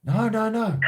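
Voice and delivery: high-pitched mock refusal; acted refusal. Acting out the reply he got.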